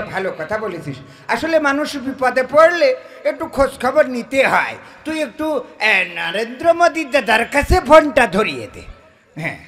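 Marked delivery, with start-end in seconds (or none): none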